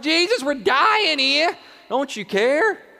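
high, loud, drawn-out plea; acted panic of drowning men; desperate cry. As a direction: cry out over a storm for rescue. Acts panicked disciples in the storm.

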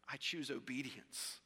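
low, hushed commitment; quiet resolve. Acted. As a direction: drop low and soft, fully decided. Voicing Jesus' choice.

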